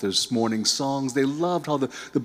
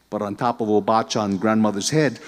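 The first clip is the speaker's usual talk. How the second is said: ordinary delivery, nothing marked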